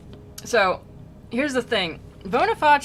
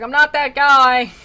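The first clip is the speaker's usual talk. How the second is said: loud, high comic denial; raised voice, protesting for laughs; mock protest; 7 semitones higher, 14 dB louder than usual. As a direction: shout a hammed-up denial. Acted denial to the spirit.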